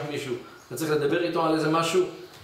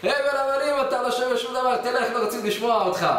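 mocking rejection; 8 semitones higher than usual. Mock rejection, high pitch.

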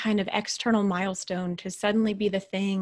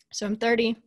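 usual speaking voice

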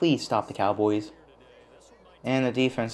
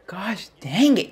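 higher, drawn-out groan of words; slow exasperation; 10 semitones higher, 4 dB louder, slower than usual. Real, not acted. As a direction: let the frustration drag. Losing the game.

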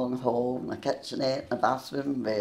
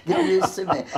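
high, loud laughter around the words; laughing; 6 semitones higher, 5 dB louder than usual. Real, not acted. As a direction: laugh it out, tickled. Laughs at own joke.

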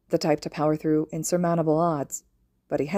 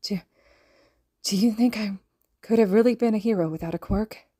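higher, halting tearful voice; 4 semitones higher than usual. A narrator's reading. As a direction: choke the question out through tears. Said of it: "Eventually, words formed".

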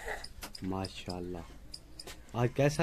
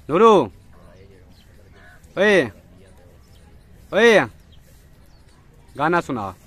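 calling out; 3 semitones higher, 8 dB louder than usual. Real, not acted. Father calling child across room.

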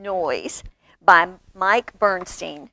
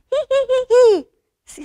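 very high mechanical titter; giggling doll; 13 semitones higher, 8 dB louder, much faster than usual. A narrator's reading. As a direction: imitate a toy doll's giggle. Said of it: "goes the doll".

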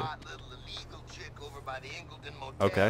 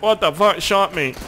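mock voice; 8 semitones higher, 8 dB louder than usual. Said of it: "He's like".

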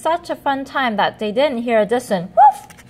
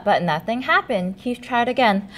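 plain reading voice

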